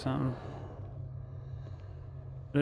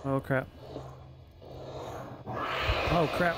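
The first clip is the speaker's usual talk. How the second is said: panicked, rising pitch; being chased. Real, not acted. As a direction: scramble to run away in fear. Chased by a monster in-game.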